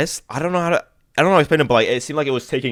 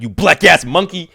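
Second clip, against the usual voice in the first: loud, higher, ranting boss; louder and higher angry supervisor; mock angry supervisor; 7 semitones higher, 7 dB louder than usual. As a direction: chew him out in an angry rant. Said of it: "starts going off on me".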